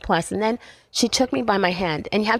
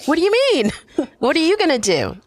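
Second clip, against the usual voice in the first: louder, higher, demanding mother; retold angry mom; 9 semitones higher, 4 dB louder than usual. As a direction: imitate mom snapping back, pressing hard. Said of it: "She got mad at me".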